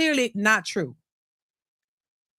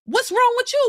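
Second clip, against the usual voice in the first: incredulous; 12 semitones higher than usual. Real, not acted. Exasperated rhetorical challenge.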